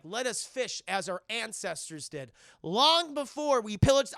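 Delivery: slow, grandiose pronouncement; mock-solemn declamation. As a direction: proclaim it like a pompous elder. Mock-solemn quote of movie character.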